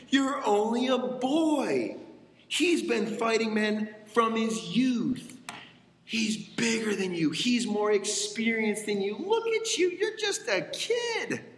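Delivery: King Saul to David, mocking and belittling; condescending. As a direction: patronize the boy like he's tiny.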